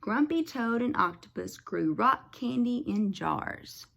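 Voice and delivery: usual speaking voice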